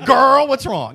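loud, high, sassy mock agent; hammed-up, unprofessional phone greeting; very bad customer service. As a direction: play an over-familiar helpline worker, loudly. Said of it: "Very bad customer service".

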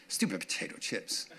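breathy whisper, scornful and low. Retold or imitated. Pastor scorning the chips in the cupboard, mock whisper. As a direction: hiss it at the chips with contempt.

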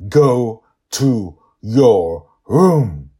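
drawn out, loud, deliberate order; each word weighted, final; slow stern command. Acted. Father ordering daughter to her room, slow and stern.

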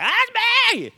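high excited yell of that's me; excited congregation member volunteering; eager shout. Retold or imitated. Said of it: "I was like".

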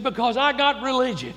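high, loud and fervent; excited. Retold or imitated. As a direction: proclaim it with joy. Shouter explains to the deacon.

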